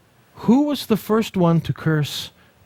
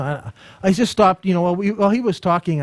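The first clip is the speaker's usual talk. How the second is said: ordinary talk, nothing marked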